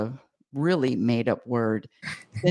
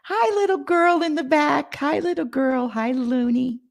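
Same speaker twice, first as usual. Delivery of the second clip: much higher cooing hello; 11 semitones higher than usual. Real, not acted. Mother cooing hello to the little girl in the back. Greeting child off camera.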